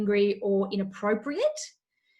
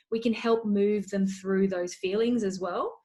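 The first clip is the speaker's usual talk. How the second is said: usual speaking voice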